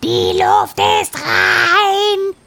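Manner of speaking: loud, drawn-out parrot call; parrot crowing. Acted. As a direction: crow it out from afar. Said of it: "den Papagei aus der Entfernung krähen".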